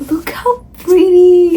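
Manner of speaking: overjoyed and slow; gushing. Real, not acted. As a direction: swoon over it. Gushing over book map.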